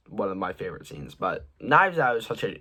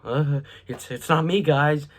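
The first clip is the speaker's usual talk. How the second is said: lightly voiced suspect, higher and louder; mock protesting suspect; 4 semitones higher, 3 dB louder than usual. Reviewer mimicking a suspect's denial to viewers, mock protest. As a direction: imitate him pleading innocence, a bit hammed-up. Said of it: "he's all".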